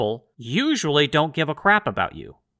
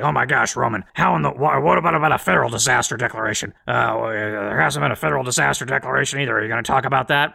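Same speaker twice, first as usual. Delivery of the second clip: lightly voiced flustered objector; mock objecting viewer; 2 semitones lower than usual. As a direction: imitate a sputtering, stammering challenger. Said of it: "I know what somebody's gonna say".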